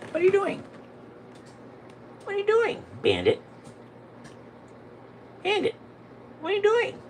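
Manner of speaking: very high, sing-song pet voice; baby talk to the cat. Acted. Owner cooing at Bandit the cat in high baby talk.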